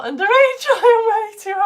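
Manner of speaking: breaking into loud, high laughter; laughing. Real, not acted. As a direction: crack up mid-line. Bursts out laughing at lyrics.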